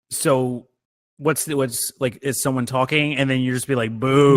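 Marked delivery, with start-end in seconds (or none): 4.0-4.3 loud, drawn-out boo; mock booing. Shown as a demonstration. Asking how booing someone mid-talk works, loud mock boo. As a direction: boo like a heckler. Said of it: "The booing is".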